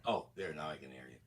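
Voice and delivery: distant, faint, muttered far off; speaking from another room; in the other room. Real, not acted. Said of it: "you sound like you're in the other room".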